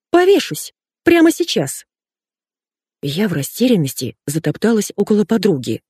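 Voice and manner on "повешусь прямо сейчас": very high, shrieky declaration; melodramatic threat. Acted. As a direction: threaten it theatrically, pitched way up. Friend melodramatically threatens to hang herself. (театрально, очень высоким голосом)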